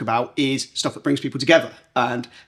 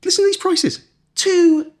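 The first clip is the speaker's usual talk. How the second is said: much higher, eager reveal; excited. Real, not acted. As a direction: build it up like big news.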